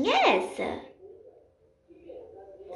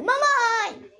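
calling out to mom; 8 semitones higher than usual. Acted. Calling mother from the hall.

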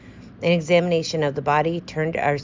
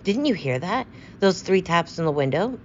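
ordinary delivery

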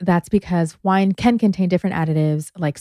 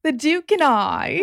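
mock grand title; 8 semitones higher than usual.

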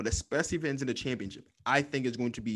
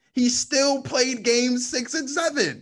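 higher, louder, slow and insistent; louder insistent repeat; 10 semitones higher, 4 dB louder, slower than usual. Real, not acted. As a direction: hammer the point home slowly.

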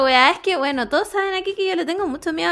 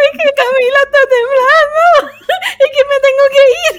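much higher and louder, rushing, half laughing; panicked; 11 semitones higher, 7 dB louder than usual. Retold or imitated. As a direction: blurt it out in retold panic. Panicking during the earthquake. (pánico recontado, agudo y fuerte, medio riendo)

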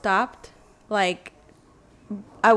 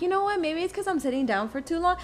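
higher-pitched self-reassurance; talking oneself out of worry; 8 semitones higher, faster than usual. Retold or imitated. Stoned storyteller talks herself out of worrying, higher-pitched self-reassurance. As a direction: reason it away in a lifted voice. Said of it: "talking myself out of it".

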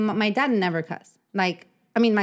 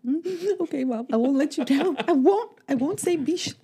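much higher, slow sugary promise; mock obedient child; 7 semitones higher than usual. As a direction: play the sweet good girl. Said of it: "I'm like".

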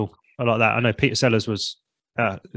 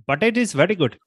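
higher-pitched Indian accent; 6 semitones higher than usual. Shown as a demonstration. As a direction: put on a thick Indian accent. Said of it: "He heard Indian and just".

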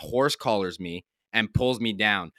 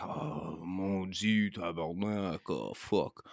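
put-on Québécois French accent, low and soft; grumbling French-Canadian curses; French chef; 3 semitones lower, 9 dB quieter, much slower than usual. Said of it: "he's like".

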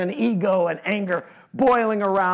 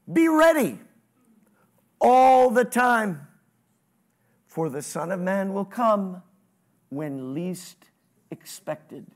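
preaching scripture; slower than usual. Acted. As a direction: proclaim the warning, then let it fade away.

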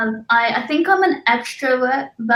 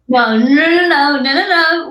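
louder, rising string of nos; protesting; 3 semitones higher, 6 dB louder than usual. Real, not acted. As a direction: refuse in a laughing panic. Refuses to do accent.

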